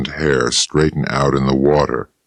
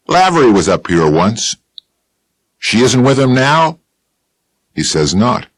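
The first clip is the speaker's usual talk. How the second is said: Bill Chess voice; 8 semitones higher, 4 dB louder than usual. Acted. Dialogue with Bill.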